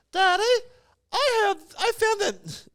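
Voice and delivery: put-on very high, small child voice; excited little kid. Five-year-old telling his dad about found cereal, excited high kid voice.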